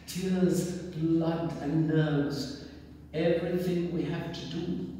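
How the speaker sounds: ordinary talk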